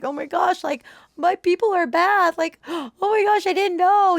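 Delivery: high, gasping retold dismay; retold shock. Retells childhood shock, gasps.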